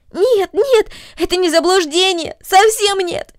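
high, loud, agitated denial; girl insists in alarm; anxious protest. A narrator's reading. Girl protests to the mother in strong alarm. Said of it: "воскликнула Корнели в сильном беспокойстве".

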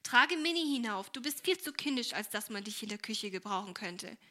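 usual speaking voice

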